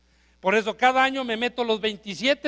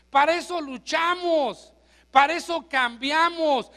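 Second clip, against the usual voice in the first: raised, fervent sermon voice; preaching at full force; 5 semitones higher, 4 dB louder than usual. Real, not acted. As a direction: drive it home to the congregation.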